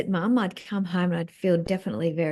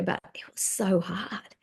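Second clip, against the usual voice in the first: whisper; 6 dB quieter, slower than usual. Real, not acted. Painful memory of visiting Mum.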